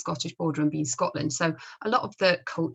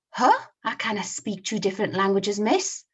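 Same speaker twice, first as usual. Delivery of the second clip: put-on Scottish accent; 3 semitones higher, 4 dB louder than usual. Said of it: "Scottish accent".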